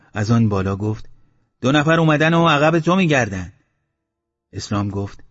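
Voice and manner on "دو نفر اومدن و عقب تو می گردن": raised, higher voice carrying down from above; calling down. A narrator's reading. Mashti Baba calls down to Eslam, raised voice. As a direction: call the news down to him. Old man calling down from roof.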